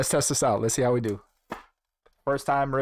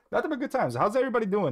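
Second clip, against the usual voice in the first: bright, high-pitched hype greeting; excited; 9 semitones higher, much faster than usual. Real, not acted. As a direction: pump up the chat, high and eager. Hyped greeting to stream chat.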